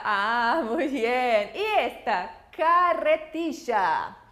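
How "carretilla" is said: "Carretilla" bem devagar, sílaba por sílaba; slow model word. Shown as a demonstration. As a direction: alongue "Carretilla" para os alunos. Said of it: "Aqui a gente pode falar carretilha". ("Carretilla" lento e articulado)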